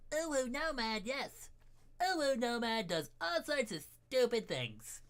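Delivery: high, loud, cute uwu voice; cutesy baby talk. Acted. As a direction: squeal it like a cartoon kitten. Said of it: "to get into that voice".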